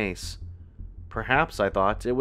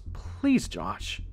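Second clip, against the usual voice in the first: urgent, pleading appeal. A narrator's reading. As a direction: plead with him, pitched up. Sister pleading with brother in story.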